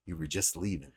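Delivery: low, soft mock-menacing line; a hint of a gravel-voiced hero; imitating Cavill's gruff line. Friend quoting the actor's voice, low and soft menace. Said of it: "the voice that comes out of that man".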